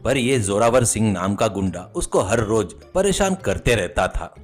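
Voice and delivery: usual speaking voice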